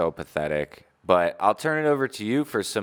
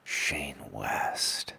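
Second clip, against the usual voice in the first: hushed whispered echo; whispering; 7 semitones lower, 7 dB quieter than usual. Acted. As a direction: breathe the name in a whisper.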